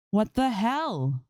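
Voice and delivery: high, slow shout of disbelief; screaming at the screen. A narrator's reading. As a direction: drag it out in a stunned yell. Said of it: "she screams at the screen".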